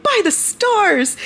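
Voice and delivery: high, loud, drawn-out exclamation; gleeful mock outburst; excited character quote. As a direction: cry it out, imitating an elated character. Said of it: "he's like".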